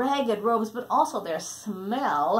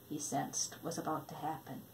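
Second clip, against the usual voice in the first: hushed; 4 semitones lower, 13 dB quieter than usual. A narrator's reading. Hushed suspense, very soft.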